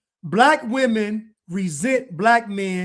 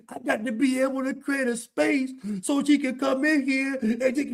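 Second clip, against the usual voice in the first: higher, softer whiny mock of a weak man; 5 semitones higher, 4 dB quieter than usual. Mocking men weak for their woman, whiny voice. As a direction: sneer it like a pushover pleading. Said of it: "Only weak men talk like this".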